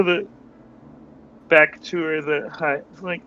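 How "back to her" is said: ordinary delivery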